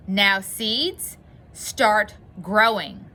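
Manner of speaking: loud, slow command; said loudly. A narrator's reading. Toad close to the ground ordering his seeds, said loudly. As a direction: project each word firmly, drawing it out. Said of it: "and said loudly".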